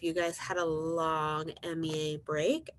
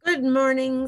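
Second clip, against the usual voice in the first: higher, louder cheery greeting; friendly ladybug; 7 semitones higher, 7 dB louder than usual. Acted. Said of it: "said the friendly ladybug".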